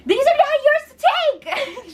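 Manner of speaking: imitating Alex yelling at Mark over her bed; imitated yelling. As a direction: rise into outrage. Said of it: "Alex is yelling".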